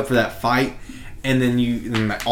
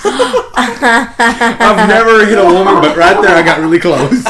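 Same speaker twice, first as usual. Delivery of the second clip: much higher and louder, laughing through the words; laughing hard; 7 semitones higher, 10 dB louder, slower than usual. Real, not acted. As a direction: crack up while confessing it. Laughing at finger-biting story.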